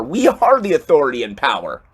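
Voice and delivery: usual speaking voice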